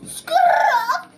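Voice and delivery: loud high skrrr with a laugh; mock car skid. Boy making a skid noise in his game, high and loud. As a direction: squeal it out like tyres, laughing.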